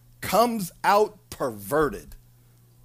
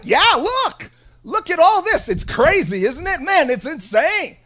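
high, loud gleeful showing-off; excited God; 7 semitones higher, 3 dB louder than usual. Acted. God excitedly showing off the chaos to Habakkuk. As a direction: boast like a thrilled kid. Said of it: "He's excited".

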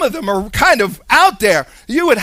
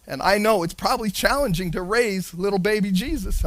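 ordinary talk

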